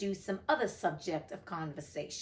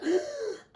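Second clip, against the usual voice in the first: sharp gasp; 12 semitones higher than usual. A narrator's reading. Baby flung at Alice.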